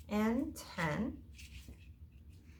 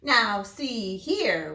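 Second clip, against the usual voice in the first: roaring Papa Bear; 7 dB louder, much slower than usual. A narrator's reading. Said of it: "roared Papa Bear".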